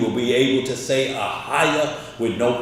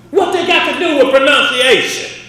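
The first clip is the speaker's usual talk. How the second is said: fiery preaching; 8 semitones higher, 5 dB louder than usual. Acted. Preacher rises to full force.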